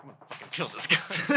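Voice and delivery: mock murderous threat. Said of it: "They're like".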